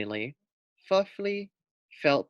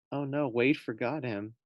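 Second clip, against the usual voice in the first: ordinary delivery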